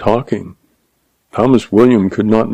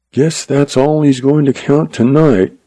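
higher musing to oneself; ruminating to himself; 5 semitones higher than usual. A narrator's reading. Man watching through the window, ruminating to himself. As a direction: muse privately, pitch lifted. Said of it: "Straight ruminated to himself".

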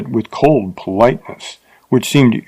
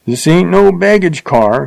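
grumbling; 7 semitones higher than usual. A narrator's reading. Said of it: "he grumbled".

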